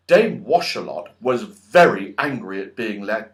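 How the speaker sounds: ordinary delivery, nothing marked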